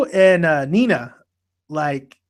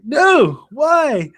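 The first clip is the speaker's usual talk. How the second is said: high, raised imitation of a despairing wail; mock anguished cry; 9 semitones higher than usual. Michael watching Erica leave him, high mock cry.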